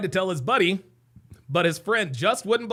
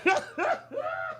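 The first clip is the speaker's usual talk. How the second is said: high laugh tailing off; hysterical laugh; 6 dB quieter than usual. Real, not acted. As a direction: dissolve into high, breathless laughter. Cracking up at video clip.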